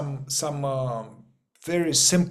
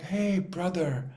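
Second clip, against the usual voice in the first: higher, softer, dreamy mock brotherly love; mock blissed-out hippie; 4 semitones higher, 3 dB quieter than usual. Mocking naive people who hug everyone, soft and sugary. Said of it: "people are like".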